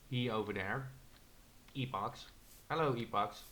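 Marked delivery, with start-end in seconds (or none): none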